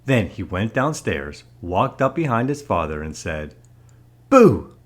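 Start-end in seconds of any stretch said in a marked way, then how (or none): 4.3-4.7 sudden loud, high BOO; startling boo. A narrator's reading. Lance sneaks up behind his father to scare him, startling boo. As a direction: jump out with a short, sharp shout.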